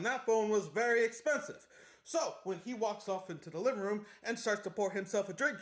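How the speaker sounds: normal talking voice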